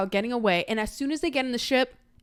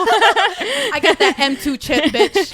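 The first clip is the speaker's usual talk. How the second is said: laughing mock brag; 6 semitones higher, 7 dB louder, slower than usual.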